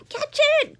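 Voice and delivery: high loud elvish shout; crying out. Acted. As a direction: elves yelling to grab it. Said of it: "cried the elves".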